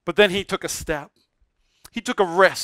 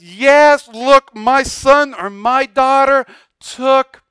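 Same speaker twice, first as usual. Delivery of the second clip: raised, emphatic delight; proud rejoicing father; 4 semitones higher, 5 dB louder, much slower than usual. Acted. God cheering a child's step, proud and emphatic. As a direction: celebrate a child's step, stretching the words. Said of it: "God says".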